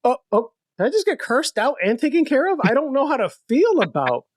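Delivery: helped driver confused by the rude New Yorker; mock bewilderment. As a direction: act dazed at being helped and cursed out. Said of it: "they'll just".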